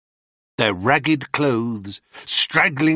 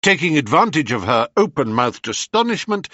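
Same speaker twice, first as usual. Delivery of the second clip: ordinary delivery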